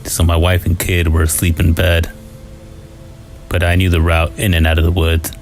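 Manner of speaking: usual speaking voice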